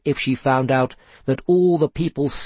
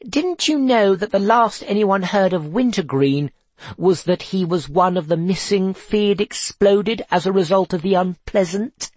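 higher, sly gossipy voice; mischievous; 6 semitones higher than usual. Acted. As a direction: tease with a grin. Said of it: "with a mischievous grin".

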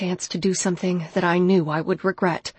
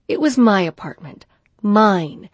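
usual speaking voice

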